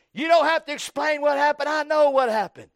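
high, loud shout that talks right over someone; mock yelling. Angry spouse cutting off an explanation, yelling. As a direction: yell it like a know-it-all in an argument. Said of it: "somebody's yelling at you so loud".

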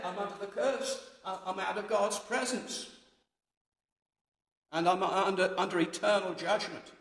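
high, loud, pleading confession; Jonah's anguish. Acted. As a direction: cry it out as the guilty prophet. Preacher voices Jonah's despair.

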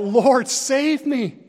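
loud, high, slow cry for help. Acted. Sinking Peter calling out to Jesus, loud desperate cry. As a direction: cry out to the Lord, drawn out and loud. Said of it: "He cried out".

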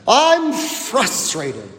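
slow, drawn-out I'm frustrated in a mock whiny excuse. Preacher voicing a believer's excuse, mock drawn-out. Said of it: "Here's how we say it".

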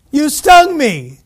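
hammed-up shock at being stung; mock outraged yell. Beaver stung mid-river.

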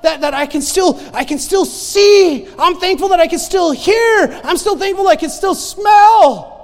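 rising from loud to a full shout; building praise shout. Acted. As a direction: climb into a shouted testimony of thanks.

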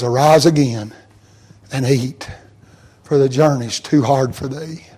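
tender hushed voice. Acted. God tenderly urges Elijah again.

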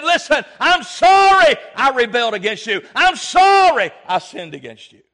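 loud pleading confession, full voice; shouted apology. Acted. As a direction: shout the king's apology as a desperate plea. Preacher voices Hezekiah's apology.

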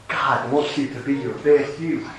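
mock a feel-good preacher through a smile; smiley, hushed motivational parody; Joel Osteen. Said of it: "talking with a continuous smile".

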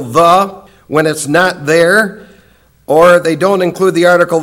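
normal talking voice

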